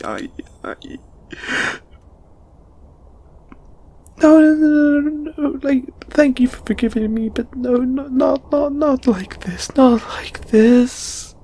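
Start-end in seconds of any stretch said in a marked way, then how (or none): 4.2-11.3 very high, overwrought stammering; distraught refusal. Acted. Refusing a friend's kindness and hug, high and distraught. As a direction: protest in a high, upset voice.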